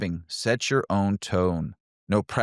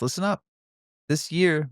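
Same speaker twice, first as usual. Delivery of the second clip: usual speaking voice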